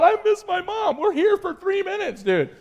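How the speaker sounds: high, whiny imitation of a panicking kid; hammed-up homesick panic; mock freaking out. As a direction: mimic a scared boy wailing for his mom.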